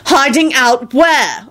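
slow, high-pitched interrogation; indignant, drawn-out question; demanding. A narrator's reading. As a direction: stretch it out as a demand. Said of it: "Elizabeth demanded".

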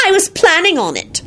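high-pitched indignant cry; shrieked retort. A narrator's reading. Wife hanging up on husband, shrieking it high. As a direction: shriek it high without much volume. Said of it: "she shrieked and hung up".

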